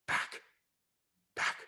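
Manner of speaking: plain reading voice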